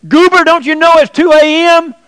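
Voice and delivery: loud, high holler over the fence; neighbor hollering. Retold or imitated. Woken neighbor hollering at Goober about the hour, loud and high. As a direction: yell at Goober through the wall. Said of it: "the neighbor next door hollered".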